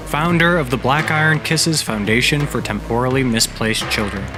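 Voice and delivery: ordinary delivery, nothing marked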